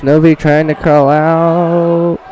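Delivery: live wrestling call; play-by-play. Acted. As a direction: call the action like an announcer. Announcer calls the match.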